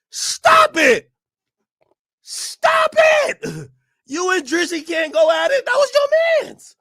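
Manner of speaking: high squeaky stop it, hammed up; mock falsetto plea; mock high pleading. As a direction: whine like a fan begging. Mock pleading voice for LeBron fans.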